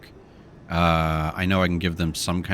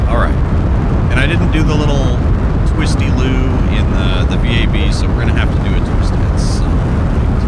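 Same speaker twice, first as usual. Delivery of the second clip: loud raised voice over engine roar; shouting over engine; 2 semitones higher, 7 dB louder than usual. Real, not acted. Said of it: "I know I'm yelling over the, uh, the engine".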